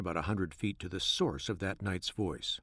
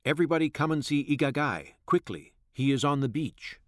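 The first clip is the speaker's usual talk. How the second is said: raised, higher narrator's yell; excited yelling; 6 semitones higher, 5 dB louder than usual. A narrator's reading. Crowd on the riverbank calling everyone to see, yelling. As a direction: call the villagers over, louder and higher. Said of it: "energetically gesticulating and yelling".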